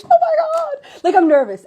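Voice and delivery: high, loud laughing squeal of nerves; giddy excitement. Real, not acted. Host gushing about her first life coach, giddy nervous squeal. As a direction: burst out laughing with nervous thrill.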